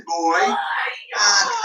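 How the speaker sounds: ordinary talk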